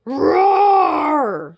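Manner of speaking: high, loud roaring shout; monster roar. Acted. Sea monster roars at the princess, a loud roar. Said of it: "said the sea monster".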